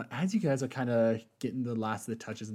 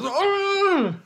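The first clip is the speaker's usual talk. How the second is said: high, loud monster screech; fish-creature cry; 9 dB louder than usual. Acted. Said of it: "making these fish noises".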